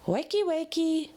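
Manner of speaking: high, loud, bouncy wake-up call; sing-song wake-up. A narrator's reading. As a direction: chirp it like a morning alarm. Pinkie wakes Applejack.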